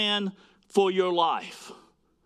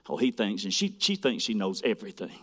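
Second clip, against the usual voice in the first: low, scoffing put-down, softer; a dismissive grumble about a know-it-all; snide attitude; 7 semitones lower, 4 dB quieter than usual. Retold or imitated. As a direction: sneer it like you're mocking someone. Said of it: "with a little bit of an attitude".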